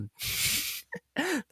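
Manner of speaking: a sudden high squeaky laugh. Real, not acted. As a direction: burst out laughing at the silly image. Laughing at own joke about swallowing. (突然一声高音的笑)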